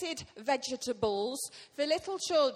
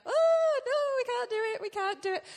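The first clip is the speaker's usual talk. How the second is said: high, loud squeaky cry of fright; a panicked little vegetable yelp; scared Israelites; 11 semitones higher, 6 dB louder than usual. Retold or imitated. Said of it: "all the Israelites are like".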